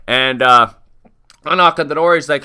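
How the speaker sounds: normal talking voice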